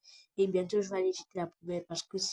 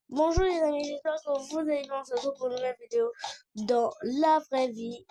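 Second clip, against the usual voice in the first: higher, louder bright presenter energy; youtuber intro voice; 5 semitones higher, 4 dB louder than usual. Acted. Kid hosting his own house tour video, peppy presenter voice. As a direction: greet the fans like a big YouTuber.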